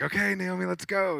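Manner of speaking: high-pitched harried call, retold; imitated brisk, high summons; hurrying someone along. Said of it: "I'm running behind".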